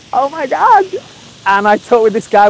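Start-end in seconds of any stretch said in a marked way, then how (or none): 0.1-0.8 high-pitched retold gasp of wonder; imitating his own astonished "can't believe it" moment; amazed realisation. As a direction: act out being stunned, voice leaping up. Acting out his sudden amazed recognition.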